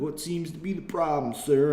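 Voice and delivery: exaggerated rural Southern police accent; country accent. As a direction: play a dim small-town Southern cop. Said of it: "they all have heavy, stupid country accents".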